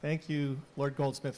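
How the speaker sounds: ordinary delivery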